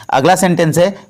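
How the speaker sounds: usual speaking voice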